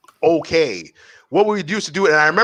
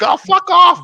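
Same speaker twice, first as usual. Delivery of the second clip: scoffing dismissal; 13 semitones higher, 6 dB louder, slower than usual. Retold or imitated. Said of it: "It's like".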